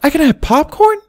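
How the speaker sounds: put-on very high, eager little girl voice; high, thrilled childlike question; excited little filly. A narrator's reading. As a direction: squeal it with delight. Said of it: "she asked excitedly".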